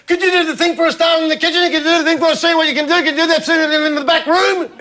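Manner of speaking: high-pitched run-on calling from afar; unintelligible partner calling. Retold or imitated. Wife calling from the back room, high garbled request. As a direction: rattle off vague requests in a high voice. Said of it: "I couldn't understand a word you said".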